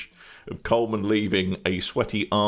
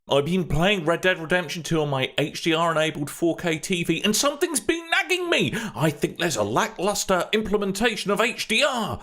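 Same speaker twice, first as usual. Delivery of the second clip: high, whining first-world moan; mock a whiny gamer; whiny complaining voice; 7 semitones higher than usual. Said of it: "people bleating on about".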